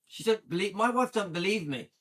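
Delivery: normal talking voice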